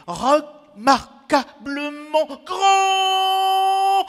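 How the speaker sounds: slow, very high, loud declamation; grandiose outcry. Acted. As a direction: build syllable by syllable to a long sustained cry. Sommet exclamé du poème. (cri grandiose, aigu et très prolongé)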